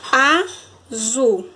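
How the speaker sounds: "azul" split slowly into a, zul; teacher sounding out "azul"; syllable by syllable. Shown as a demonstration. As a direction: pause between the two syllables of "azul".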